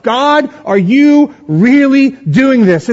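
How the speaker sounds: high, slow, drawn-out wonder; incredulous awe. Acted. Psalmist asking God if this is real, incredulous awe. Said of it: "it's like he's pinching himself".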